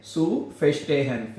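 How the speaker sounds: zu verstehen, said very slowly; slow demo. Shown as a demonstration. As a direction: spell out zu verstehen syllable by syllable, very slow. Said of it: "हैं". (धीरे धीरे साफ़ उच्चारण)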